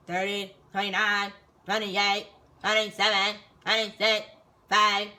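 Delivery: slow, separated counted numbers; sergeant's countdown. Retold or imitated. Sergeant timing recruits' bag search, slow barked countdown. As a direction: count it off loudly, beat by beat.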